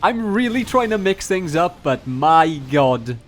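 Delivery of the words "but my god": ordinary delivery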